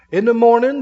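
usual speaking voice

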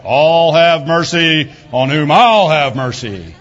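a stern judge laying down the law, mocked; harsh mock reading. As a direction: ham up a cold, unforgiving God. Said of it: "that's how they say it".

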